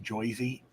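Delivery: "Joyzy", Jersey with OY for ER. Retold or imitated. Host recounting a TikTok, "Joyzy" with an oy. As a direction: say "Joyzy" with an oy vowel. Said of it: "while saying Joyzy".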